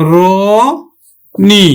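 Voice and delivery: র and নি said apart, র as রো; syllable by syllable. Shown as a demonstration. Teacher breaking the word for students, syllable by syllable. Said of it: "আমরা উচ্চারণ করব কিন্তু ঘ".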